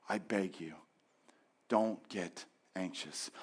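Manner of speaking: soft, slow plea; pleading softly. Acted. Pastor begging his listeners not to worry, softly. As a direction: beg them tenderly. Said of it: "It is a gentle request".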